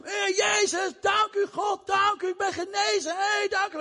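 high shouted thanksgiving; loud praise. Acted. Healed Samaritan praises God aloud, high joyful shout. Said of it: "loofde God met luide stem".

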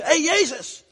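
high, pleading call. Acted. Lepers call to Jesus though forbidden, high pleading shout. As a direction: yell across the distance for help. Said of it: "En toch riepen ze".

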